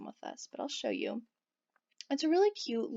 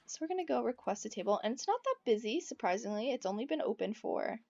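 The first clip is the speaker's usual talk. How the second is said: normal talking voice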